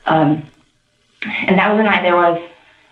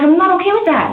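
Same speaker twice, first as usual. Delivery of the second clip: high-pitched protest, quoted; retold indignant refusal; 14 semitones higher than usual. Witness retells refusing him, indignant. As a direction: replay your refusal, pitched way up.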